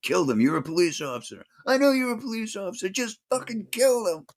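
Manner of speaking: high mock yell climbing to a strained, very high pitch; imitated yell. Hunsaker pleading with Roger for revenge, imitated yell. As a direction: imitate a frantic man yelling, pitch soaring as it builds. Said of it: "listening to Hunsaker yell".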